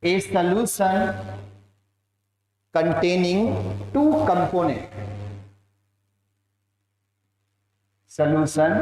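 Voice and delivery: slow, measured dictation in short chunks. Real, not acted. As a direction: dictate slowly, phrase by phrase. Teacher dictates a definition to class.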